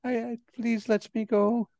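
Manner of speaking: begging to be released; frightened pleading. Acted. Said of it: "She is very afraid of you".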